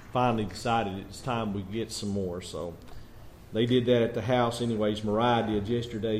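ordinary delivery

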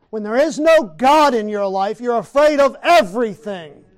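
loud, fast fiery preaching; preaching at full force. Acted. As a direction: thunder it from the pulpit.

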